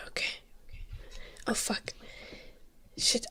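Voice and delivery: normal talking voice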